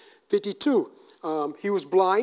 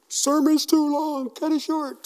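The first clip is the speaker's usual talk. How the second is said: higher-pitched, drawn-out mock griping; mock complaint; 9 semitones higher, 4 dB louder, slower than usual. Always saying the sermon's too long, mock complaint. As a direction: complain in a raised, jokey voice.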